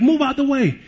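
mock angry yell. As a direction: toss off the driver's rude yell in mock anger. Angry driver honking at him.

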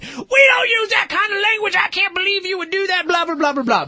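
high-pitched ranting parent voice; hammed-up outraged telling-off; mock angry scolding. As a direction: shriek a mock scolding, running on without a breath. Imagined angry scolding of child.

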